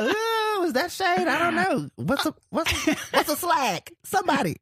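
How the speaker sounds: high-pitched, playful puzzled questions; hammed-up high confused voice; mock bewilderment. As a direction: pipe up high as if baffled by slang. Acting out confusion over slang.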